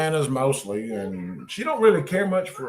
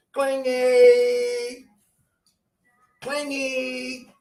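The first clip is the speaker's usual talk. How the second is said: put-on high baby voice; 8 semitones higher, much slower than usual. Coaxing baby to repeat.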